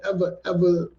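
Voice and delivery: ordinary delivery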